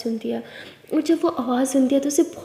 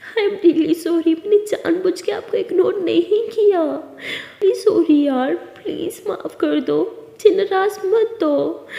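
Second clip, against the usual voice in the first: higher, slow tearful apology; crying voice; 5 semitones higher, 3 dB louder, slower than usual. Acted. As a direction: plead through tears. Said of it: "रोती आवाज़ में बोलती है". (रोती आवाज़ में माफ़ी माँगते हुए)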